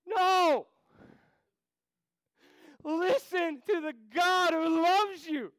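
loud, high, slow pulpit shouting; full-force preaching. Acted. Preacher crying out to the congregation to walk with God. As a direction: thunder it out to the congregation.

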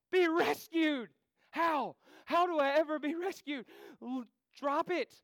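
loud, high, slow pulpit shouting; full-force preaching. Acted. Preacher crying out to the congregation to walk with God. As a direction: thunder it out to the congregation.

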